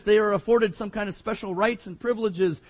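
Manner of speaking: usual speaking voice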